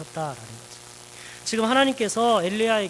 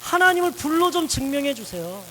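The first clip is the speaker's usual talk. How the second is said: louder, higher insistent pleading; pleading demand; 6 semitones higher, 5 dB louder than usual. Acted. Elijah demanding fire from God, loud and insistent. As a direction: beg God like a stubborn child demanding proof. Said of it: "막 떼를 썼죠".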